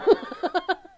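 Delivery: high, loud cackling laugh; real laughter. Real, not acted. Joking she has no time for tea, real laughter. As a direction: burst out laughing at the idea.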